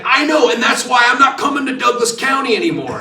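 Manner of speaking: loud, high mock outrage; going nuts. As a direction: imitate an indignant suspect yelling in protest. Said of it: "he's going nuts in the car".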